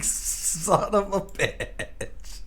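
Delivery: slow, drawn-out swearing at the game; slow cussing in frustration; drawn-out curse. Real, not acted. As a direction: curse it out slowly, stretching the words. Cursing at the game.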